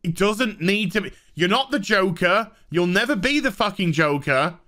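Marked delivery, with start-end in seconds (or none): none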